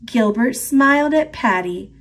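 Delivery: usual speaking voice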